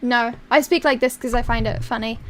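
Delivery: normal talking voice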